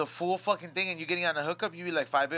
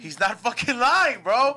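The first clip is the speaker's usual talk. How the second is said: amazed; 7 semitones higher, 7 dB louder, slower than usual. Real, not acted. Astonished at the Google result.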